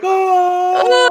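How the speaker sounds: long, loud, high "ohhh"; mock wail, held out high and loud; imitated groan. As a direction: play a horse lying there wailing. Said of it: "He's, like, laying there like".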